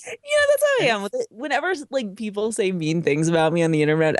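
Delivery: ordinary talk, nothing marked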